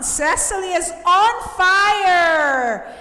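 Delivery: loud, high and slow, stretching the last word; excited announcing. Real, not acted. Teacher announcing who's up front in the quiz, excited and drawn out. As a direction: hype up the leader like a sports commentator.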